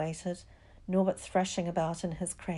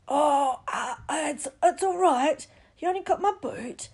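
high, loud, yelping reassurance; yelp, then insist it's fine; pained yelp; 9 semitones higher, 7 dB louder than usual. A narrator's reading. Gamekeeper bitten by baby dragon reassures children, pained.

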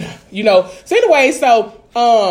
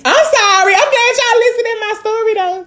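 high-pitched, playful sorry; mock apology; 10 semitones higher than usual. Real, not acted. Thanking listeners after venting her story, high mock apology. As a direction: lift the voice high for a cutesy apology.

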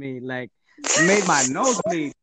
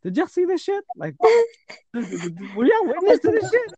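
high, slow outraged appeal to siblings; outraged disbelief; 14 semitones higher, 3 dB louder, slower than usual. Retold or imitated. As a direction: plead with the siblings in shock, drawn out. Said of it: "I was like".

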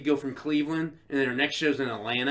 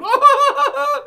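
high, loud hammed-up wail; mock crying; 11 dB louder than usual. Imitating a viewer watching the show, mock crying. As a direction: wail like a badly hurt fan, played for laughs. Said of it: "He's crying".

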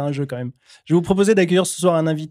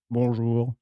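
low crow voice; 5 semitones lower, 7 dB quieter than usual. Acted. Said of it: "les deux voix sont vraiment incroyables".

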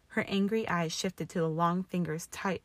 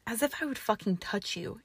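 growl; 4 semitones higher than usual. A narrator's reading. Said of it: "he growled".

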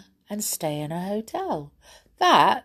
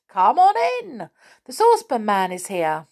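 loud, much higher, beaming hello; cheery Moonface greeting; 5 semitones higher, 6 dB louder than usual. Acted. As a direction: sing out a big happy welcome. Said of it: "he said".

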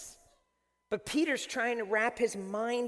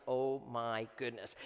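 low, slow, soft wonder; hushed amazement; 9 semitones lower, 5 dB quieter, slower than usual. Retold or imitated. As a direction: draw it out quietly in awe. Retells his awe at magic trick.

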